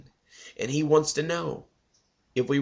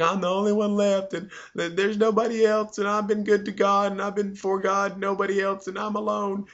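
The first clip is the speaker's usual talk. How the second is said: high, whimpering self-pity, pitched well up; whiny Elijah; 8 semitones higher than usual. Retold or imitated. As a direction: whine it like a cowardly prophet feeling sorry for himself. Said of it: "kinda sounds like a pansy".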